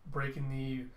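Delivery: plain reading voice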